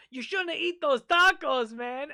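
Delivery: stranger's scolding. Retold or imitated. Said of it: "And he goes".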